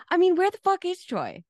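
high, hammed-up indignant outburst; mock aggression. Quoting the movie line to a co-host, mock aggressive. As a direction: burst in out of the blue, imitating an aggressive guest. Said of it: "he comes in with such aggression out of the blue".

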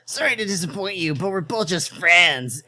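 put-on lightly defensive young man; a touch of defensive protest; defensive cry. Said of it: "Ash cried defensively".

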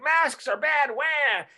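high whining mockery; mocking whiny voice. Mocking Trump people as whiny babies. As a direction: whine it like a crybaby. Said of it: "the Trump people are like".

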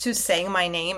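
normal talking voice

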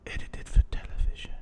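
hushed, dark European-accented whisper. Shown as a demonstration. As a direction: breathe the line darkly. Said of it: "you said it so dark".

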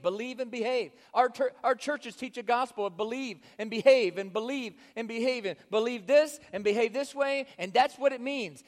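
loud, driving sermon build; fiery preaching. Acted. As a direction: preach it hard to the congregation. Preaching at full force.

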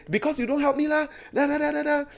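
very high-pitched mock griping; whiny complaint. Preacher turning on God after scolding himself, mock whining. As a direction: whine the blame upward, trailing into nonsense syllables. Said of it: "I turn back to God and say".

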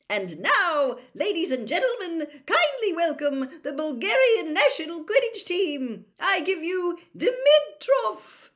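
high, loud booming announcer voice; stadium announcer. A narrator's reading. Stadium announcer introducing team.

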